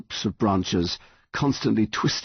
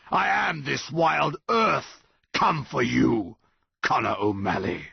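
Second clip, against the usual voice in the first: slow monster threat; 7 semitones higher than usual. A narrator's reading.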